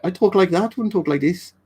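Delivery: high-pitched mock Geordie that slips into Brummie; bad Geordie accent. Shown as a demonstration. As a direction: attempt Geordie, landing nearer Brummie. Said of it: "That was more of a Brummie accent".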